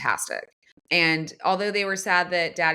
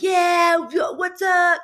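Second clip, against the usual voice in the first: loud, high mock gloating shout; obnoxious cheering; 11 semitones higher, 6 dB louder, much slower than usual. Fan showing what winners shouldn't do, obnoxious shouted cheer. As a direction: crow it like an obnoxious winning fan. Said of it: "We're not like".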